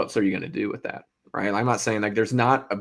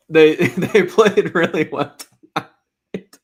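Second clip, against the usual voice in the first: slow, louder words broken by a laugh; can't keep a straight face saying it; laughing through it; 7 semitones higher, 9 dB louder, much slower than usual. Real, not acted. Cohost praising the team, laughing mid-sentence. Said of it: "Sorry, not, not doing that".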